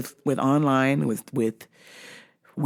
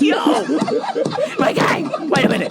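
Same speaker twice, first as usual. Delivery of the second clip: laughing; 12 semitones higher, 3 dB louder, slower than usual. Retold or imitated. Laughing reaction to friend's story.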